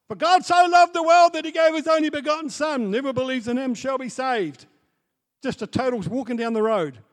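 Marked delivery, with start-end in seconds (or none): 0.1-4.7 high, loud yelled scripture. Retold or imitated. Preacher retells God's instruction, loud yelled verse. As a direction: bellow the verse through the door. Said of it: "yell out at the top of your voice".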